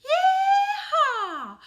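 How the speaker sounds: high, loud whooping yeehaw with a laugh; big joyful cowboy whoop. Shown as a demonstration. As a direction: holler it like a cowboy calling the cattle in. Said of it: "Let me hear you say it".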